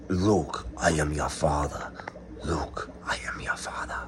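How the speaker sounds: whispered Vader quote. Retold or imitated.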